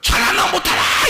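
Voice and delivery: a God glaring at us, hammed up; glaring watcher. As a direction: bark it like a strict overseer. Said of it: "눈 똑바로 뜨고 우리를 계속 쳐다보면".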